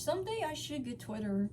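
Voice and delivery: plain reading voice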